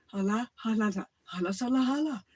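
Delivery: put-on hala, hala mumbled like old Soviet speech; mock Soviet mumbling; slurred Russian speech. Shown as a demonstration. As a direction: babble hala, sala in a fast run. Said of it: "they talk like that".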